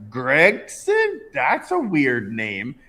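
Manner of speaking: high, loud tipsy exclamation; drunken girl. Retold or imitated. Man retells drunk girl at the bar reacting to his name, drunken voice. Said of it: "She's like, drunkenly".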